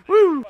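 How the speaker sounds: loud, high-pitched woo; high whoop. Real, not acted. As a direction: let out a whoop after laughing. Whoop after laughing at himself.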